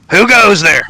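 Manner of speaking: high-pitched crossbow guard; challenging sentry voice. Acted. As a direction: challenge intruders in a high character voice. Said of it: "you hear a voice say".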